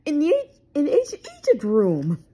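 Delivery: high, loud cry; loud, high-pitched alarm; Shaggy's yelp. A narrator's reading. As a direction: yelp out where it happened. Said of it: "yelped Shaggy".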